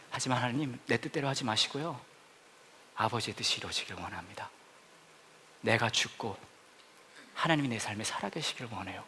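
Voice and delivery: soft, low surrendering prayer; quiet prayer. Pretend. Pastor voicing a prayer of surrender to God, hushed. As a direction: pray it humbly under the breath.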